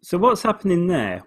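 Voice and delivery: usual speaking voice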